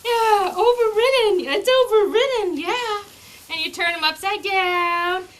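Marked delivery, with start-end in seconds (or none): 0.0-3.0 higher, louder, upbeat; loud happy praise over the puppy's protest; cheerful overriding. Shown as a demonstration. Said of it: "gets overridden like this".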